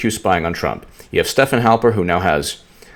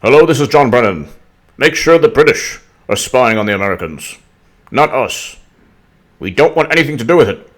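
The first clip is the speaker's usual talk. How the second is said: louder put-on voice of a scheming spy boss; mock official on the phone, loud and pushy; hammed-up Brennan giving orders; John Brennan impression; 3 semitones higher, 5 dB louder than usual. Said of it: "I'm doing a John Brennan".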